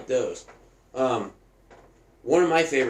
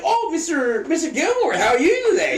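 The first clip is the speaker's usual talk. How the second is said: high, gushing mock-polite greeting; Ben Stiller's orderly; 14 semitones higher, 3 dB louder than usual. Orderly greeting a visiting grandson, high fake-nice imitation. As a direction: greet sweetly in a put-on high voice. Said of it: "Ben Stiller's so funny in it".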